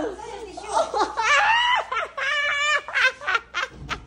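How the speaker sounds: long, high-pitched laughing fit; helpless laughter. Real, not acted. Friend is about to throw up. (hindi mapigil na halakhak)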